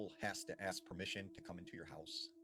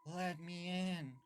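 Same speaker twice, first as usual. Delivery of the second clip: put-on high, drawn-out child's plea; slow, eerie begging in a raised pitch; pleading young boy; 8 semitones higher, much slower than usual. Strange boy at the front door asking to be let in, pleading. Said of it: "she said this boy responded".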